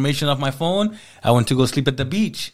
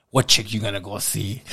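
lower, suspicious mock girlfriend voice; imitating his jealous girlfriend, lower voice; 5 semitones lower than usual. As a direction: needle him like a jealous partner. Girlfriend's jealous question, quoted.

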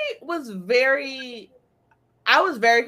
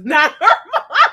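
laughing; 7 semitones higher, 10 dB louder than usual. Real, not acted. Laughs mid-sentence.

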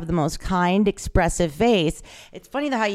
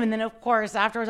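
ordinary talk, nothing marked

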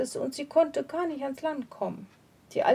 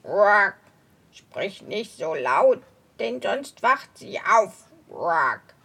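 low croaking toad, hushing; 4 semitones lower, 5 dB louder, slower than usual. Acted. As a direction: croak a warning like an old toad. Old toad hushes son.

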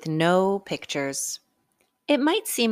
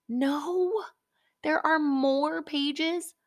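ordinary delivery

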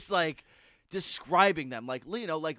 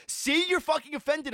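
teasing shout; 11 semitones higher, 6 dB louder than usual. Retold or imitated. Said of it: "she goes".

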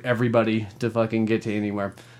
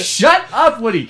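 exasperated retort; 7 semitones higher, 3 dB louder, slower than usual. Retold or imitated. Acted exasperated retort to Woody.

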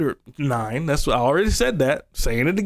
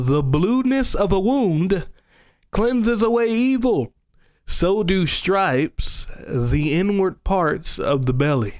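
slow, weighty reading of the verse; scripture reading; 4 semitones higher, slower than usual. Acted. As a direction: lay each phrase down with weight. Reads scripture slowly and solemnly.

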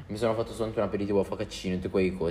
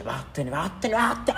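rising to a sharp high shout; shouted get out; 8 semitones higher, slower than usual. Retold or imitated. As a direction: yell at the intruding sister. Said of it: "tu dici sottovoce".

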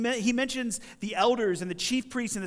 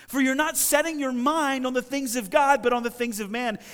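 higher, louder, forceful reproof; explosive rebuke; 4 semitones higher, 5 dB louder than usual. Acted. Preacher as Jesus rebuking Peter for his mindset, explosive. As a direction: drive the correction home in an acted rebuke. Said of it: "he has this explosive rebuke".

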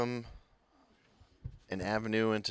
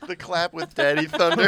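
louder, higher mock-scandalized repeat; mock outrage; 6 semitones higher, 10 dB louder than usual. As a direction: repeat it in shocked disbelief. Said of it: "Don't ever say that again".

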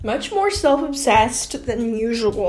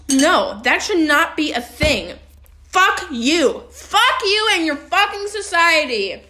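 loud, higher-pitched angry outburst; heated; 5 semitones higher, 5 dB louder, slower than usual. Real, not acted. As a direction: lash out in real anger, punching the swearing. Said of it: "I'm making myself heated right now".

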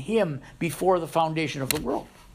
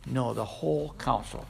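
ordinary talk

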